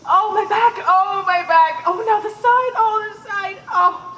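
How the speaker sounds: loud, moaning complaint of aches; groaning in pain. Acted. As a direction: moan and wail about the pain. Nurse moans about aches.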